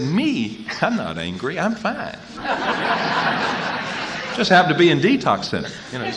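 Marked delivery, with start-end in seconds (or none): 0.0-2.2 high, airy denial of anger; mock denial. To his counselor in group, mock-innocent high denial. 2.2-4.3 airy high laugh at himself; breathy laugh. Real, not acted. Speaker laughing at his old self, airy high laugh.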